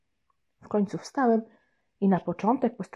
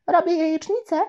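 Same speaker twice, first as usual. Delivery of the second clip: put-on high, louder proud little girl; little sister; 9 semitones higher, 4 dB louder than usual. Little sister tells her brother she is cooking, high and proud. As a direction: announce it proudly. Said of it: "powiedziała na mój widok".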